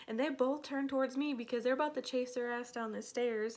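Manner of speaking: usual speaking voice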